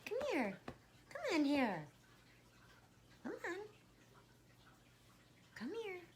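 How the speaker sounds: high sing-song call; calling the cat. Real, not acted. Owner calling her cat to the camera, high coaxing.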